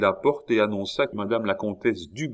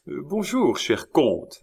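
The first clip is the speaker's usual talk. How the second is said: friendly greeting; 6 semitones higher than usual. A narrator's reading. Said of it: "avec son gai sourire".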